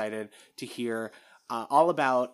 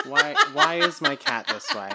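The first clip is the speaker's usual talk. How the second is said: high, laughing complaint; laughing exasperation; 13 semitones higher, 5 dB louder than usual. Real, not acted. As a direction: laugh in disbelief. Cat misbehaving, laughing.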